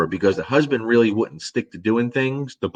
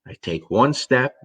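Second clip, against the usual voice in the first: ordinary talk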